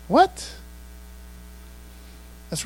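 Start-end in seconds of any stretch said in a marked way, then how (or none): 0.1-0.5 surprised what. Acted. Reacts to the king's deal.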